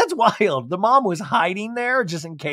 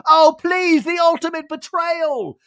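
much higher, louder theatrical wail; mock drama; 13 semitones higher, 5 dB louder than usual. [name] over the sink, wailing melodramatically about betrayal. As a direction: ham it up like a tragedy. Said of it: "he's washing it".

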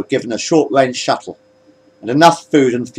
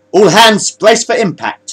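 high, louder, slowing command; 10 semitones higher, 5 dB louder, slower than usual. A narrator's reading. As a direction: brace the whole crew with an order. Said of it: "he commanded".

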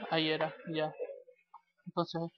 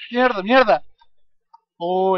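angry shout; 2 semitones higher, 9 dB louder, slower than usual. Real, not acted. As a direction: yell the curse in frustration. Enemy attack in game.

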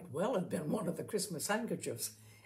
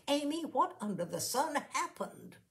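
bursting out; 8 semitones higher than usual. A narrator's reading. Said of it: "as though it had been on the tip of her tongue for hours".

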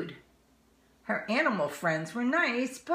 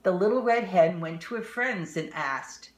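plain reading voice

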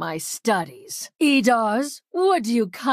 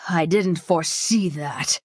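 usual speaking voice

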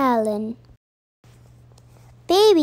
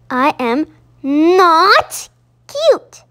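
slow, louder indignant shout; 3 semitones higher, 6 dB louder, slower than usual. Acted. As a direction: stamp the words out like an angry little owl. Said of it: "shouted Baby Owl".

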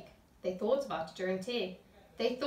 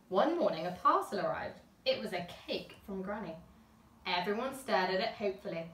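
ordinary delivery

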